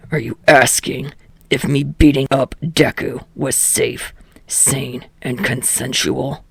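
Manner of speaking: low, sardonic asking voice; gruff Katsuki. A narrator's reading. Katsuki sarcastically questioning the teacher. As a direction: ask it low, deadpan and sarcastic.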